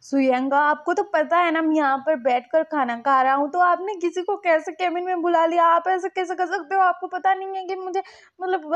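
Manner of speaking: angry whining. Retold or imitated. Continued tantrum at Suyang.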